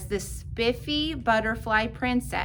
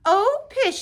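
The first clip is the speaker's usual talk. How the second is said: dismissive mother; 12 semitones higher, 6 dB louder than usual. Acted. Mother brushes off teasing.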